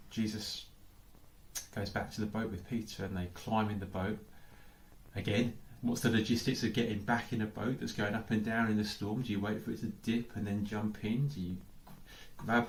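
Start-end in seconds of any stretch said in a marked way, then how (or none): none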